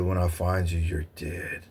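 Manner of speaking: low, quiet, growly menace fading to a near hush; menacing bear. Retold or imitated. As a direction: loom over them like a hunting beast. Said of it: "Or he said".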